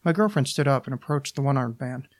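usual speaking voice

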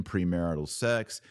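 ordinary delivery

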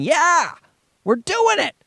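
high, excited cheer, the wife's genes celebrating; mock victory cheer. Wife's genes cheering at the baby, excited high cheer. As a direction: squeal it out like you just won. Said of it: "her genetic code screaming".

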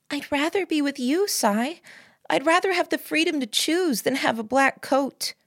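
high-pitched heartfelt protest; pleading girlfriend. Acted. Narrator voices the girlfriend's plea.

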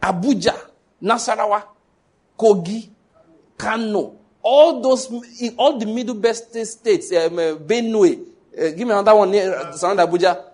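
loud, rising rally shout; roaring rallying speech. Acted. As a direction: declare it like a battle cry. Loud proclamation over Nigerian states.

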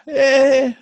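a sudden high laugh, loud; amused laughing yelp; high laughing burst. Real, not acted. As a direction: burst out laughing mid-word.

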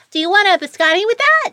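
high, quick put-on barista voice; quick upsell in a very high voice; barista's voice. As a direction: imitate the high-voiced barista, talking fast. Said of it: "that's actually how you sound".

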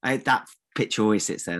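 ordinary talk, nothing marked

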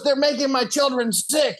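high, slow complaint; aggrieved chief. Retold or imitated. Chief complaining about migrants, high slow voice. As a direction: plead for the children like a worried elder. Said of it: "He goes".

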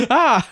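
short high, loud mock yelp of fright; startled yelp. Tiny man startled by giant face.

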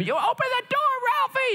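very high, strained mock yell; mom yelling. Mom to son locked in the bathroom, yelling. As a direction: holler like a mother at a locked door. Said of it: "like, yelling at him".

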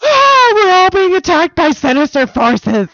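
high, loud hammed-up wail; mock panic. Co-host imitating every desert place freaking out, mock panic. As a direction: wail it like a panicking crowd, exaggerated. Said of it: "would just be like".

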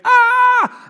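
high, loud startled scream; imitating a fright at home alone at night; mock scream. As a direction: shriek as if someone jumped out at you. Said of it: "You scream".

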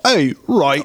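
put-on light Cockney girl voice. Punk rock girls barging in, lightly Cockney. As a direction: drop into a hint of Cockney. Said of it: "they're all Cockney".

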